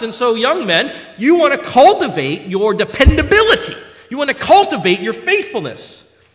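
loud, high, ringing exhortation; full-force preaching. Acted. Preacher exhorting young men and elders, full-force preaching. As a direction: drive it home to the congregation.

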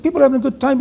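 high-pitched imitation Cork accent. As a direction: lilt it high in a mock thick Cork accent. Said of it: "almost indecipherable Cork accent".

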